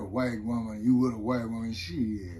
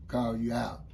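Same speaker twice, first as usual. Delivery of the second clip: ordinary talk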